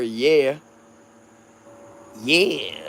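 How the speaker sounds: drawn out "yeah" with a soft y; his signature "yeah"; his old catchphrase. Shown as a demonstration. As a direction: say "Yeah" the way he used to. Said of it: "I used to say it just like that".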